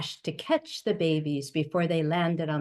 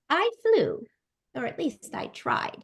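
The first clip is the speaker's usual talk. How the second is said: put-on baby bird; 6 semitones higher than usual. A narrator's reading. Baby bird voiced in story.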